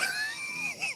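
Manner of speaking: short high burst of real laughter; sudden laugh. Real, not acted.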